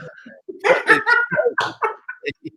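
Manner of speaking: long high-pitched burst of laughter. Real, not acted. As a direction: let out a long squealing laugh. Laughing at cod botherer joke.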